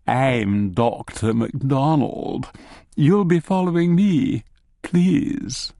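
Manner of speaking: slow ultra-posh Morningside Edinburgh voice; posh Morningside accent. Acted. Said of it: "speaks with an ultra-posh Morningside accent".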